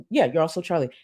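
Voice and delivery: ordinary delivery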